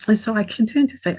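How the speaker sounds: ordinary talk, nothing marked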